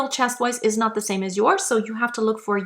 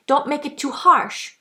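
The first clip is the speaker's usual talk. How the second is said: ordinary delivery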